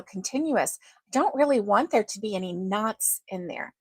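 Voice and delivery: usual speaking voice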